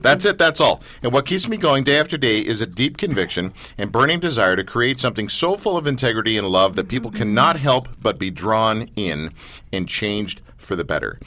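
measured, sincere reading, sinking low at the close; earnest statement read aloud. Acted. Reading a mission statement to the group, measured and earnest. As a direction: read it out as a heartfelt creed.

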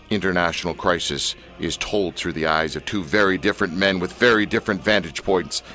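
low, deep announcer read; dramatic trailer narration over music; radio voice. Shown as a demonstration. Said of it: "use my best radio voice".

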